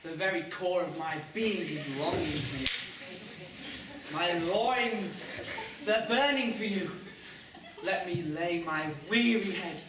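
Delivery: melodramatic love declaration, drawn out at the end; ardent lover. Shown as a demonstration. Boy coaching friend to woo Linda, overblown ardour. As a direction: declare passion like a romantic hero. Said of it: "And you say".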